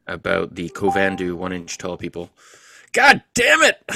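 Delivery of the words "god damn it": sudden loud high cry of exasperation; loud frustrated exclamation; outburst. Real, not acted. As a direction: blurt it out at full volume. Exclamation while reading aloud.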